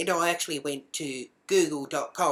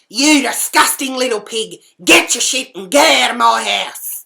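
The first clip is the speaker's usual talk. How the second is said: loud, high furious eviction; disgusted shouting; 8 semitones higher, 15 dB louder than usual. Retold or imitated. Seeing the mess and evicting the housemate, furious disgusted shout. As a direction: yell in outrage, full force.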